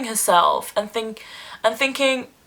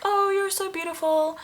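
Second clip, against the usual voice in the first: much higher, airy fan compliment; mock gushing commenter; 10 semitones higher, 5 dB quieter than usual. Imitating Instagram commenters complimenting her, higher gushing voice. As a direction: play a fan cooing at her photo. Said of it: "they're like".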